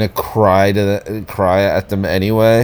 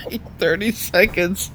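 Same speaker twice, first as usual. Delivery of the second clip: ordinary talk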